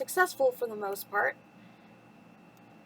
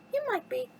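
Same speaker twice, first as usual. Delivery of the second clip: high-pitched coaxing teapot voice; hint at it in a high voice; acted high housekeeper; Missus Potts; 13 semitones higher than usual. A narrator's reading. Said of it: "said Missus Potts".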